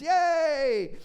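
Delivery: loud high whoop; cheer. Acted. Preacher cheering Jesus' promise to the church, loud whoop. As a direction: whoop it out with joy.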